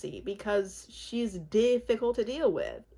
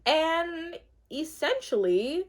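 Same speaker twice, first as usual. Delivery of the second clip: ordinary talk, nothing marked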